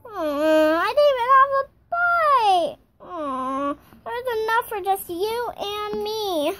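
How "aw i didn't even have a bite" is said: high, loud crying complaint about I didn't even have a bite; whiny sob over I didn't even have a bite; crying whine. Pretend. Doll whining over the spaghetti.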